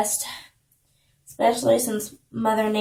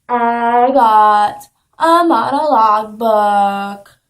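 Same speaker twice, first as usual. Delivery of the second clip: loud, drawn-out silly proclamation; loud announcement; 9 dB louder, much slower than usual. Pretend. Announcing a new monologue book, loud and drawn-out. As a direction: stretch every word out loudly.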